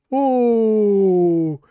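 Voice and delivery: high, loud gasp of delight; a real thrilled oh at a good pull. Real, not acted. Rare card pulled, delighted.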